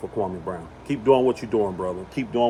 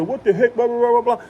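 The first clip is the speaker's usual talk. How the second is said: mocking people; 9 semitones higher than usual. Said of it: "people say".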